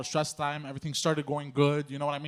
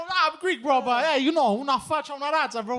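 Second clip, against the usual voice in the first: Greek-Italian bro voice; 9 semitones higher, 4 dB louder than usual. Retold or imitated. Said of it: "I go".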